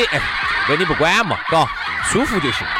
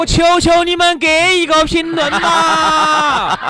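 high, slow hammed-up begging; mock pleading; 10 semitones higher, 4 dB louder, much slower than usual. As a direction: plead comically for comments. Begging listeners for comments.

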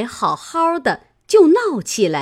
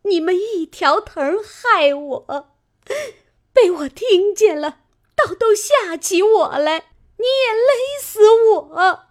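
high, loud shriek building into sobs; crying scream; 11 semitones higher, 5 dB louder than usual. A narrator's reading. Fengjie throws herself at her husband, shrieking and sobbing. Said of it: "叫道".

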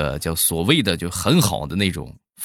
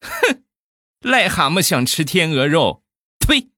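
contemptuous shout, spitting out the last word; a loud mocking tirade; loud scolding; 10 semitones higher, 4 dB louder than usual. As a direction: scold loudly in scorn. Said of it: "然后大声地骂道".

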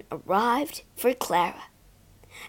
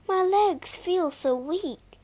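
trembling; 8 semitones higher, slower than usual. Acted. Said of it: "said Clara, trembling".

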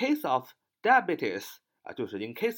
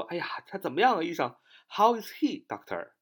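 high-pitched question to the doctor, dropping on "doctor"; hospital visitor asking; 5 semitones higher than usual. Acted. At the hospital, asking the doctor after a patient, high-pitched query. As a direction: ask as an anxious-to-know visitor would. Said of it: "这是我们常常去医院问的啊".

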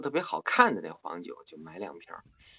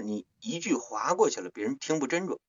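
ordinary talk, nothing marked

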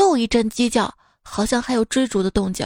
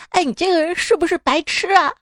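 indignant scolding; 7 semitones higher than usual. Retold or imitated. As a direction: snap it like a fed-up child. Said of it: "骂道".

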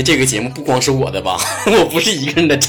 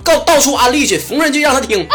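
ordinary talk

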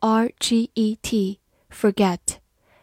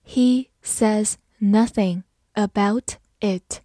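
slow, one word at a time; slow reading; slower than usual. Shown as a demonstration. Teacher leads learners through the example sentence, slowly. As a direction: read it slowly with gaps between words. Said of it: "好，慢慢来读".

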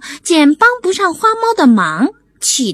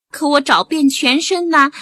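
usual speaking voice

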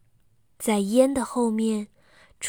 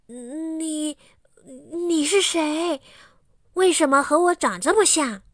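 stunned fear; 6 semitones higher than usual. Acted. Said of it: "小伟吓呆了".

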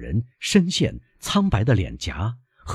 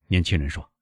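plain reading voice

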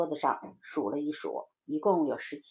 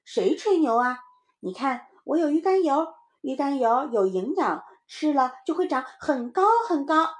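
put-on high, loud kid defending himself; boasting little child; 6 semitones higher, 5 dB louder than usual. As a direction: convince your playmate like a child. (小孩高声争辩夸口)

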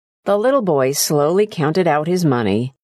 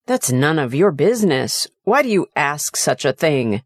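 plain reading voice

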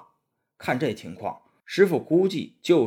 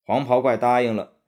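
plain reading voice

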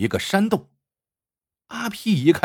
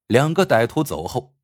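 plain reading voice

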